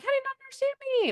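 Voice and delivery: high, fast mock exasperation; exasperated. Speaker mocking her own frustration at listeners, high fast exasperation. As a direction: squeak it out like nobody gets you.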